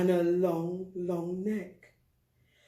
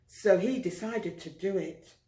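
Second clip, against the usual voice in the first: plain reading voice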